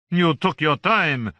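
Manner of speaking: voce di Voltaire, più alta, lenta; un Voltaire ironico e pacato. A narrator's reading. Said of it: "the little man called".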